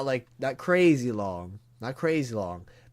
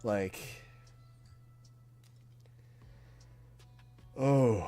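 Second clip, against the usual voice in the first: getting emotional; 9 dB quieter than usual. Real, not acted. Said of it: "I'm getting kind of emotional right now".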